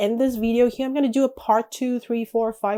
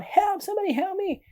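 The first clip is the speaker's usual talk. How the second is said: high-pitched, half-volume cry for rescue; mock shout for help; 10 semitones higher than usual. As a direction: call for help up high, without real force.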